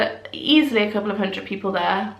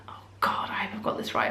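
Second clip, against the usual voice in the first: hushed worried whisper; a real nervous whisper; whispered aside; 10 dB quieter, faster than usual. Real, not acted. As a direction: breathe it to yourself, embarrassed. Worried aside about a name.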